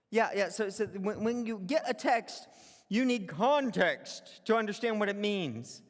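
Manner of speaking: normal talking voice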